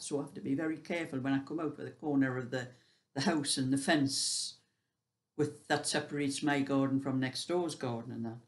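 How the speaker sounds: ordinary delivery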